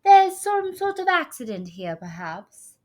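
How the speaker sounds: high, loud fake English accent; grand lady in a put-on English accent. A narrator's reading. Star remarking on the accident, fake English accent. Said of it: "she said in a fake English accent".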